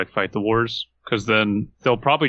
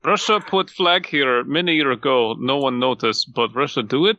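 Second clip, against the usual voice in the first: put-on playful Russian accent laying claim; mock Russian accent; 4 semitones higher than usual. Mocking Russian claim to Ukraine.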